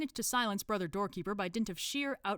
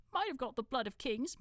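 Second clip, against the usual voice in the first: higher, mildly grumbled mutter; 7 semitones higher than usual. Acted. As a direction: grumble it half to yourself. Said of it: "muttered Brother Dunnikin".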